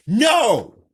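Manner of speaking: high loud yell. A narrator's reading. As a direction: shout the denial. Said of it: "Robert yells".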